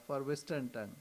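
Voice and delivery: ordinary talk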